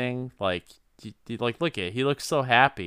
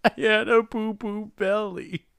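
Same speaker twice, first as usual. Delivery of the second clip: put-on high babyish child voice; kid voice; 8 semitones higher than usual. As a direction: say it like a little kid.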